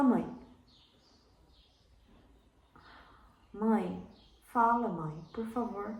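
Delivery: subdued plea with long silences; waiting for answers. Shown as a demonstration. Actor begging an imagined mother to speak, pleading with pauses. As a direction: plead gently, pausing after each call. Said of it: "dar o tempo da interpretação".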